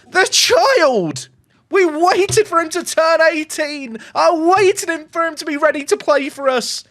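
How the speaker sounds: high, escalating commentator frenzy; ecstatic goal call. Real, not acted. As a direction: scream the goal home in jubilation. Commentating live on own team scoring.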